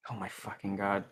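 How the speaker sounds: muffled behind a hand; hand over mouth. Real, not acted. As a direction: swear it quietly through covered mouth. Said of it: "while my mouth is covered, muffling".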